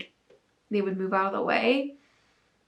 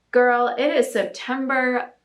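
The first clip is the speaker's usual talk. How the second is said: usual speaking voice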